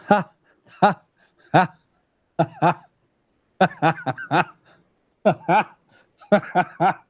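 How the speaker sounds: big bursts of laughter; laughing hard. Real, not acted. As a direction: cackle at the punchline.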